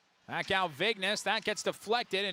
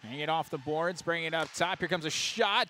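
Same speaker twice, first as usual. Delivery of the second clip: normal talking voice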